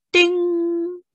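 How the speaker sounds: high held ding like a bell; the wrong-answer bell. A narrator's reading. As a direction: ring out like a bell. Imitating the bell.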